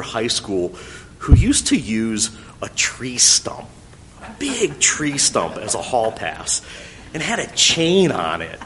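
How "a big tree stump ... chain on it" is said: plain reading voice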